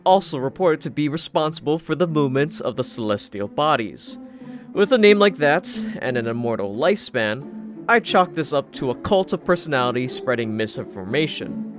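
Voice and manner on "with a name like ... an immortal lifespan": plain reading voice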